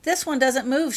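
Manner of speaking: put-on high, loud boyish shout; a boy shouting to his friends about a still figure. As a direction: call out excitedly across the room. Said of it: "shouted Albus".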